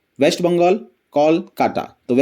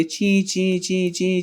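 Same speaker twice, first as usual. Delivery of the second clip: soft, high little chirps of a rain-soaked bird; imitated bird chirps; 4 semitones higher, 5 dB quieter, much slower than usual. Teacher to students, voicing the wet bird: soft high chirps. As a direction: peep like a wet sparrow, softly. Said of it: "इस तरह से चिल्ला रही है".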